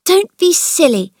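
high, loud scolding; mildly cross. Acted. Mary scolding Colin for his worry, mildly cross and high. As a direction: tell him off lightly. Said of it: "she said a little crossly".

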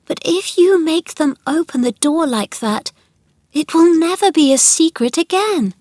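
put-on high, distressed girl's voice; upset pleading. A narrator's reading. Mary begs Colin to keep the secret, upset. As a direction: plead as if near tears.

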